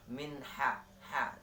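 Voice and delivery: ordinary talk, nothing marked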